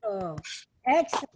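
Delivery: ordinary talk, nothing marked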